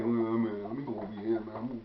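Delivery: low, soft acted retreat; quiet, deflated mock grumbling; sheepish grumble. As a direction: slink off under your breath. Acts out sheepishly slinking away.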